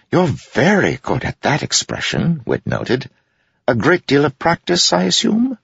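high, sing-song mocking jester. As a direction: tease with rising glee. Wit mocks Amaram.

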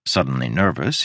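usual speaking voice

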